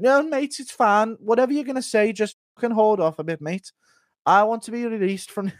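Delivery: high-pitched insistent pleading; Darren Till. Acted. Fighter asking to be released from the UFC, high insistent impression. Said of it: "Impersonate Till wanting a release from the U F C".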